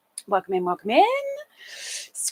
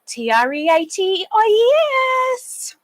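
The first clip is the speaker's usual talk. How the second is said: imitated treat call, rising higher; 10 semitones higher than usual. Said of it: "and then calling out".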